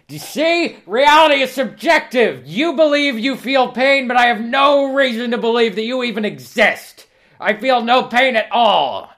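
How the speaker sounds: very high, loud, slow; high drawn-out manic shriek; shrieking Examiner. Acted. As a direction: screech it like an unhinged torturer.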